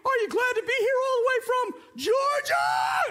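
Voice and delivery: very high, peppy cartoon voice; squeaky mock cartoon host; cartoon character. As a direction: imitate an excitable little cartoon. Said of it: "this little cartoon pops up".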